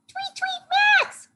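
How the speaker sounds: very high chirpy bird; tiny squeaky storybook bird; surprised bird. A narrator's reading. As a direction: tweet the name in falsetto. Bird character sees friend.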